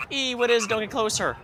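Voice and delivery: put-on startled girl voice. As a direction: pull back in mock fright. Voicing frightened NPC.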